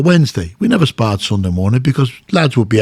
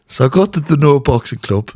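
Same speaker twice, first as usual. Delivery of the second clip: normal talking voice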